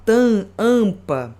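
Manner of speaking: "Tam, ampa" very slow, the vowel sent through the nose; nasal vowel demo. Shown as a demonstration. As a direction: draw out "Tam, ampa" to show the nasal sound. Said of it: "ele sai nasal". ("Tam, ampa" bem devagar, som nasal)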